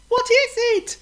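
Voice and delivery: cheeky bird from the air; teasing bird voice; bird calling down. Acted. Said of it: "called Chirp".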